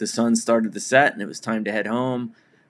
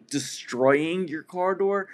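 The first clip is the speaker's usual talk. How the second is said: incredulous; 7 semitones higher, slower than usual. Retold or imitated. Incredulous retort to driver.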